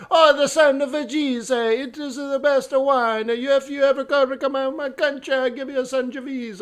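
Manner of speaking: put-on high, effusive caricature Italian accent. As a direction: rave like an Italian wine lover. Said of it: "and he's Italian".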